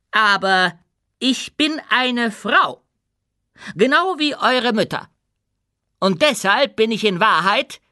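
high, loud, measured public declaration; proclaiming queen. A narrator's reading. Ruler reveals herself to her subjects, proclaiming loudly. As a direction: announce it to the whole hall with weight. Ruler announces she is a woman.

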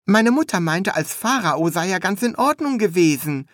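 high, chatty apprentice; chattering young apprentice. A narrator's reading. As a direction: prattle on high and casual. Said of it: "im Plauderton".

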